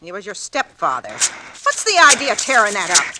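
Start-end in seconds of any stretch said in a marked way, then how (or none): 1.1-3.1 rising fury over the torn photo, louder and louder; mother scolding son, getting louder; angry scolding. Acted. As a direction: scold him hard and build.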